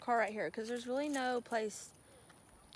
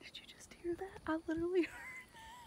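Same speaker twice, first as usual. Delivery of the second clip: hushed spooky whisper; 9 semitones higher, 10 dB quieter than usual. Real, not acted. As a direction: whisper it like something's out there. Listening for ghost cries at creek.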